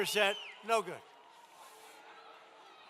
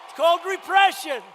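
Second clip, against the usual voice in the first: louder and higher rally shout; shouted slogan; 6 semitones higher, 9 dB louder than usual. Real, not acted. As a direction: hammer the line at the crowd. Repeated slogan, then shouted.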